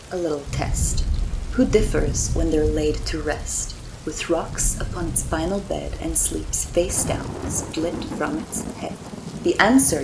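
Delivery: lightly spooky vampire host rhyming; a hint of a creepy crypt keeper; horror host. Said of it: "Do you mind if I break character?".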